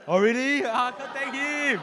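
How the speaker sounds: high, loud surprise at the news; surprised. Real, not acted. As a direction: caught off guard, voice jumps up. Learns father-in-law is absent.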